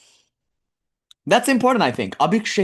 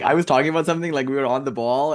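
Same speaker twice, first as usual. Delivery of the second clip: ordinary talk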